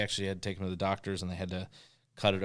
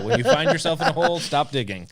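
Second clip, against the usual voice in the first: higher and louder, playful scolding; mock urgent advice; 7 semitones higher, 8 dB louder than usual. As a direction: call it out in jest to a friend. Joking advice to co-host.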